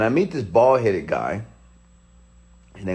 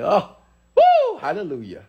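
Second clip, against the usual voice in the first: high whooping hallelujah; joyful whoop; 9 semitones higher than usual. Retold or imitated. Joe finally answering, a high whooping hallelujah.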